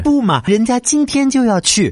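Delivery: put-on high, slow whiny baby talk; cutesy whiny pleading. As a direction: whine it like a clingy girlfriend. Cutesy reply to scammer.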